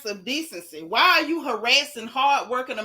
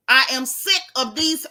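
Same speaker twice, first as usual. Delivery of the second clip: ordinary talk, nothing marked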